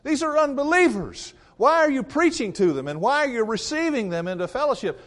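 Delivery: high, loud, outraged accusation; shrill mock outrage at Peter, all the way up. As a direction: critics in Jerusalem scold Peter in high, loud mock alarm. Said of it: "They're freaking out".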